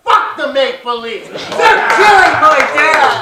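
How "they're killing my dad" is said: slow, high, loud mock wail; hammed-up grief for dad; mock anguished cry. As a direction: wail it like the world is ending. Father's hockey grief, mock wail.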